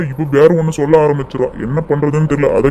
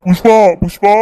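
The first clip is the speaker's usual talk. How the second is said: raised, higher call to his wife; 4 semitones higher than usual. Acted. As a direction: call urgently into the next room. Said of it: "இப்படி கத்திட்டு வராரு". (சத்தமா கூப்பிடுவது)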